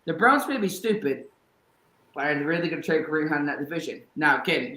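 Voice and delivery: usual speaking voice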